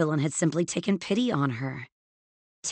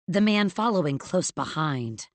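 usual speaking voice

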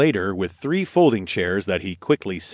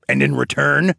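raised, pointed question; demanding question; 6 semitones higher, 4 dB louder than usual. A narrator's reading. Grandfather demanding terms from strangers, pointed question. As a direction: demand the terms, lifting the end. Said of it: "Darwin demanded".

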